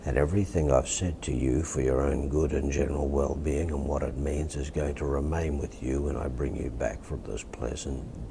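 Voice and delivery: ordinary talk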